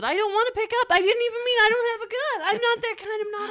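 high, loud flustered denial. Real, not acted. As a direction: scramble to take it back. Panicked backpedalling about the gun.

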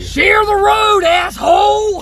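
shouting at a driver, drawn out; road rage yell. Acted. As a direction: yell out the car window.

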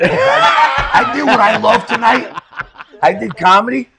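cocky laughing rant, high and loud; imitating Tony. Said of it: "Tony's like".

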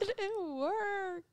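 high, drawn-out fake sob; mock crying wail. Woman mimics a man crying after sex, slow mock wail. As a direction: wail it slowly, pretending to cry.

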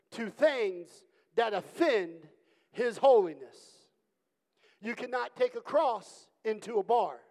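preaching. Acted. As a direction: preach it with rising force. Preaching with rising force.

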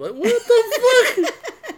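high, loud, stretched laugh-through disbelief. Real, not acted. Friend laughing at his own story, disbelieving laughter. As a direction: crack up as the words come out.

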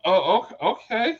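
a hint of offended surprise; taken aback. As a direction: act taken aback by the snub. Reacting to the snub.

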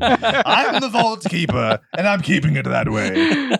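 slow, laughing horror host; Vault Keeper voice. Acted. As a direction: boom it out slowly like a horror host. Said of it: "I will finish as the Vault Keeper".

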